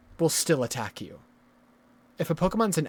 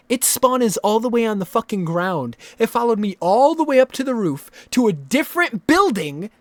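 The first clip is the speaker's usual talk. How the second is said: loud, higher exasperated rant; angry rant; 5 semitones higher, 10 dB louder than usual. Real, not acted. Gamer ranting about a Pokemon that followed him, angry. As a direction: vent the outrage.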